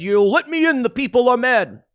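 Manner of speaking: lightly voiced whiny Bernie; Bernie impression. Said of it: "knocked on the door and said".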